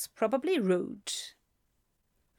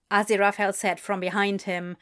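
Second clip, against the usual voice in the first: usual speaking voice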